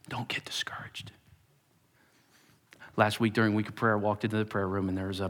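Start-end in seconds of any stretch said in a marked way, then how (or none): 0.1-1.1 soft, breathy comfort; older church member comforting, whispered; gentle whisper. Retold or imitated. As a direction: whisper it close, reassuring. Comforting words voiced.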